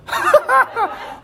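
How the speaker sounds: squealing giggle; real laugh; high laugh. Real, not acted. Laughs after joke.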